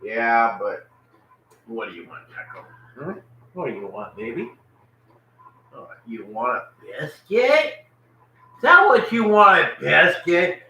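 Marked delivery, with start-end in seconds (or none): 1.7-4.5 softer, lower voice for the dogs; soft pet talk. Real, not acted. Man cooing softly to his dogs. 5.7-10.6 excited pet talk. Real, not acted. Man teasing his dog with a biscuit, rising excitement.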